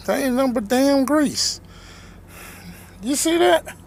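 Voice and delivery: high, indignant rant; worked-up rant. Real, not acted. Ranting about greasy nuggets.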